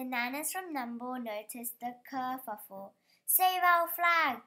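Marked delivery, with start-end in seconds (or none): 3.3-4.4 loud, slow, high plea; cry out. A narrator's reading. Nanas crying out to save their flag, loud alarm. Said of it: "they cried".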